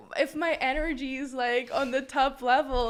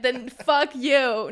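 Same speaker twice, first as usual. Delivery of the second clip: louder, slowed, every word punched; emphatic dismissal; 10 dB louder, much slower than usual. Real, not acted. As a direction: slam the door on him. Rejecting a disrespectful man.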